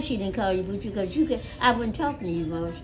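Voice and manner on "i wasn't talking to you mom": normal talking voice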